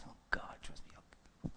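hushed, breathy whisper to God; whispered prayer. Retold or imitated. Preacher recalling his plea, whispering to God.

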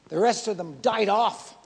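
ordinary talk, nothing marked

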